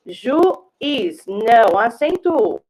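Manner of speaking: ordinary talk, nothing marked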